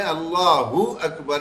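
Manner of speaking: "اللہ اکبر" as "Allaahu akbar"; "اللہ اکبر" with a clean "u" joining the words; correct takbeer. Shown as a demonstration. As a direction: model "اللہ اکبر" with "hu", not "ho". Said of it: "کر کے ادائیگی کرنی ہے".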